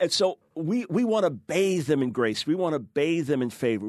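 normal talking voice

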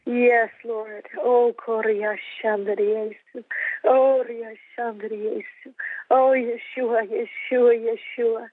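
rising, impassioned prayer with bursts of tongues; fervent prayer. Acted. Minister praying aloud for Israel on a broadcast, fervent and rising. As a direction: pour it out to God, building in fervor.

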